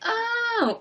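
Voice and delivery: a high, breathy gasp of awe; gushing fan. Retold or imitated. Fans watching the Disney show, swooning at the lead. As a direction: swoon like a starstruck viewer. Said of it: "people would be like".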